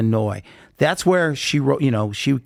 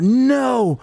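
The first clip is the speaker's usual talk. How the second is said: higher, urgent retold panic; mock-panicked insistence, pitched up; freaking out; 6 semitones higher than usual. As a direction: act out someone freaking out and ordering it gone. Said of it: "freaking out".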